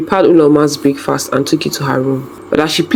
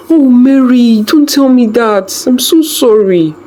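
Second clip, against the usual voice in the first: distressed sympathy; 8 semitones higher, 3 dB louder, slower than usual. A narrator's reading. Friend grieving at bedside.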